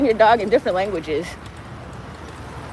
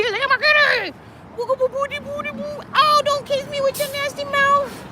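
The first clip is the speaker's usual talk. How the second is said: much higher, cooing pet voice; 13 semitones higher, 3 dB quieter than usual. Real, not acted. Owner cooing at her cat, high-pitched baby talk.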